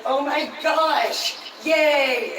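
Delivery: hammed-up thrilled new hire; mock gushing. New hire accepting the promotion, mock gushing. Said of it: "And you're like".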